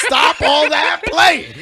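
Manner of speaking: loud, high, drawn-out command with laughter; mock scolding. Co-host tells the mother to get tough, loud playful scolding. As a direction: belt it out slowly, half laughing.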